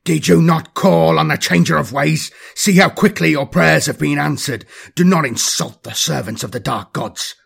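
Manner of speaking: loud, harsh rebuke, easing by the end; harsher voice. A narrator's reading. Captain rebuking his hesitant sergeant, in a harsher voice. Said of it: "Abadas's voice became harsher".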